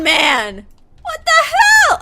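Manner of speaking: high, loud crying what the hell; outraged howl; anguished shout. Real, not acted. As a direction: wail it at the screen. Streamer yelling at game character.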